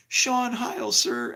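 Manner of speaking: high, timid answer; imitating the kid; kid's voice. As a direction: answer meekly. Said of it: "He goes".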